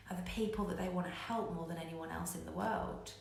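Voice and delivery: normal talking voice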